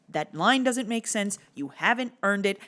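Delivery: normal talking voice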